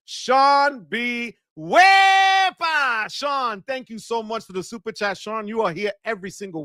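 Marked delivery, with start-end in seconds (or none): 1.6-3.1 long, high, loud whoop, stretched out; celebratory holler. Real, not acted. Streamer hailing a super chat donor, celebratory holler. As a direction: belt it out, holding the first syllable. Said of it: "I'm gonna lose my voice".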